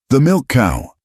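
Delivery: high, loud shout. A narrator's reading. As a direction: shout the warning. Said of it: "Silas shouted".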